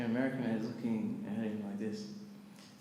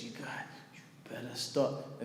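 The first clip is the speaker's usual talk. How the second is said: in his face; 2 semitones lower, 8 dB quieter than usual. Retold or imitated. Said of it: "gets in his face like this".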